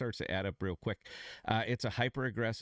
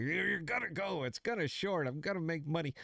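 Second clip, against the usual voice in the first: higher-pitched fired-up mock rant; hammed-up angry self-talk, pitched up high; mock mad; 8 semitones higher than usual. Trader ranting at the chart he shorted, mock anger pitched up high. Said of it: "I'm just mad. I'm like".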